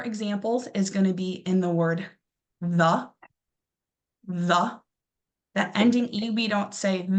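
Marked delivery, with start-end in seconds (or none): 2.6-4.8 the said as a quick weak thuh, not eh; reduced the. Shown as a demonstration. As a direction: skip lightly over the ending of the. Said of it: "We quickly skip over it".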